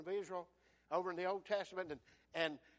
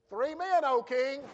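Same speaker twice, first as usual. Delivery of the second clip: loud, raised-pitch answer to royalty; calling out; 5 semitones higher, 9 dB louder, slower than usual. Acted. Servants answer the king at the furnace, called out loudly. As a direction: call it up smartly to the king.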